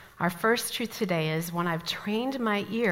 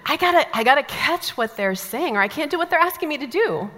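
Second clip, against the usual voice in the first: higher, louder, mock-flustered; flustered newcomer; 7 semitones higher, 6 dB louder than usual. Newcomer desperate to follow her coworkers' instructions, hammed-up fluster. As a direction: act out straining hard to keep up.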